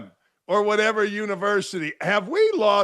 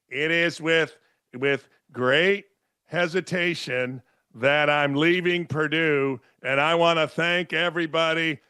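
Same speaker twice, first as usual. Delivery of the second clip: mock solemn announcement; 4 semitones lower than usual. Mocking athletes' social media announcements, low and solemn. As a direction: read it like a fake-humble press release. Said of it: "I'm doing all the memes right now".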